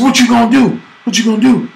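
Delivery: hammed-up kid taunt. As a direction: challenge him like a playground bully. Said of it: "He said".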